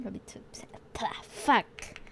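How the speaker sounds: frustrated whisper; whispered curse. Real, not acted. Streamer cursing softly at the word. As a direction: give up under your breath.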